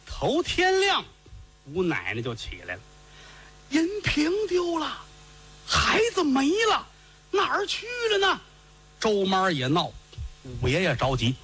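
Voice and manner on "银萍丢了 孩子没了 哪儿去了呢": put-on grandma wailing that the girl is gone; frantic old woman. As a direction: cry it out like a panicked grandmother. Grandma cries out missing girl.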